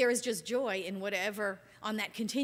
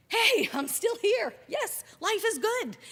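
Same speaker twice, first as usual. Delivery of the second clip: much higher and louder, slow and beaming; joyful waking up; 9 semitones higher, 6 dB louder, slower than usual. Pretend. Opening your eyes like a little kid, slow and delighted. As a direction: greet the morning like a happy little kid. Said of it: "you're like".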